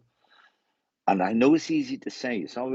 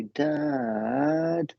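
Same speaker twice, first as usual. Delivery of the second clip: put-on high little-child voice calling Dad; childlike plea. The lady wheedling her dad, high and childlike. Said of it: "she used to change her voice".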